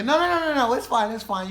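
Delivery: high, loud string of protests; acted-out nervous insistence, starting high; frantic refusal. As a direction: hurriedly talk him out of it. Frantically refusing drunk man's lift.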